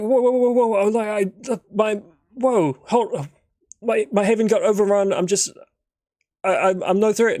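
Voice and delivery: high, loud, stumbling words of a scared man at gunpoint; panicked pleading. Acted. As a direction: hands up, begging them not to shoot.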